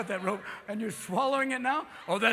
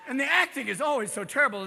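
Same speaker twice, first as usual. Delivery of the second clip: ordinary talk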